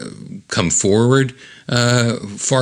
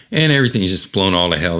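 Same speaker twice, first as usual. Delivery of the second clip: usual speaking voice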